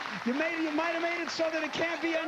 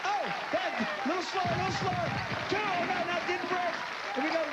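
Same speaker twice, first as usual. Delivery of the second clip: raised, eager game-show commentary, urging her on; live play-by-play; 5 semitones higher than usual. Real, not acted. Host calling the balloon round to the contestants, excited play-by-play. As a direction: call the action as it happens, pitch up.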